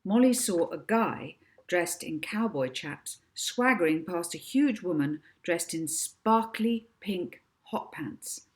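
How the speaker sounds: ordinary delivery, nothing marked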